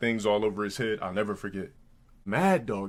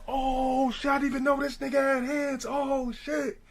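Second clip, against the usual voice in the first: high, drawn-out mock crowd cries; crowd flipping out. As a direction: imitate stunned onlookers freaking out, pitched way up. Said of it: "Everybody, like, flipping out, going crazy".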